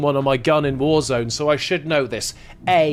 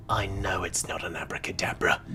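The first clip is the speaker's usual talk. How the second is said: low whispered aside; conspiratorial whisper; 8 semitones lower, 10 dB quieter than usual. Real, not acted. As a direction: whisper it like a secret. Confides he sees through the trick.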